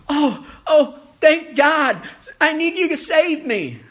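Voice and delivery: high, loud, relieved gushing; frantic relief. Acted. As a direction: gasp out thanks from the branch. Man relieved at God's answer.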